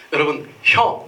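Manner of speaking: ordinary talk, nothing marked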